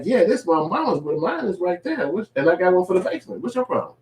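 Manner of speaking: high, careless bragging voice; mock dismissive brag. Debtor showing off his TVs, high careless mock brag. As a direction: brush him off casually.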